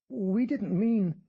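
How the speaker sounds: the husband caught by the priest, flustered; flustered American man. Acted. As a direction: fumble an excuse, caught out.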